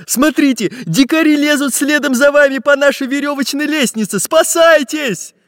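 high, urgent warning call; alarmed cry. A narrator's reading. Pencil warning the pirates of climbing savages, crying out. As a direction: cry the alarm, stretching the last word. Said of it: "крикнул пиратам Карандаш".

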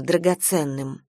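ordinary delivery

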